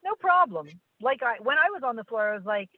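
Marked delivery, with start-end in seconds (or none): 0.0-0.7 higher-pitched, carefree brush-off; unbothered, pitched up; breezy reassurance. Pretend. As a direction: toss it off as no trouble. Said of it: "trained myself to say".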